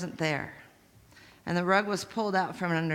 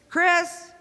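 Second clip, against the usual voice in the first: loud, high call across the house; 8 semitones higher, 6 dB louder, much slower than usual. Retold or imitated. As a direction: shout to her from another room. Sister calling out across house.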